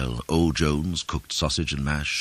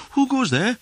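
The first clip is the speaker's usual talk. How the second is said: very high challenge, called out; piping lookout's challenge; sentry's call; 4 dB louder than usual. A narrator's reading. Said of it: "called Will Sentry".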